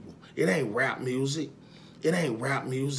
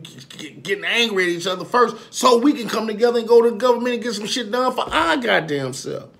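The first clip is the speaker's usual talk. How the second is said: higher and louder, heated call to action; heated rise; 8 semitones higher, 7 dB louder than usual. Real, not acted. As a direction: build it into a rallying cry. Rising rant about unity.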